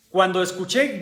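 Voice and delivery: ordinary talk, nothing marked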